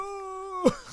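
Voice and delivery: very high surprised cry; a sudden panicked gasp of a word; mock startled yelp. Watcher panicking.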